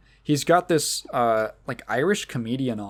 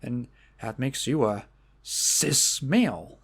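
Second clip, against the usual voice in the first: slow, drawn-out Norm-style punchline delivery; lightly imitated comic, slowly landing the punchline; Norm Macdonald; 4 dB quieter, slower than usual. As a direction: stretch it out as a deadpan comedian's reveal. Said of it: "And, and Norm goes".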